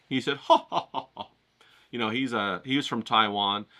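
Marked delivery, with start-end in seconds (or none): 0.4-1.3 imitated teacher's belly laugh, ha-ha-ha; mock laugh at a student's question; hearty put-on laugh, dying away; Yim. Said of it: "he just laughed at me".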